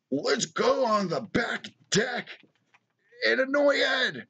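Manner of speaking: high, slow mocking quote; mocking the neighbor. Host imitates annoying neighbor heading for his deck, high drawn-out mockery. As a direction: stretch it out high like a taunting imitation. Mocks the neighbor's supposed words.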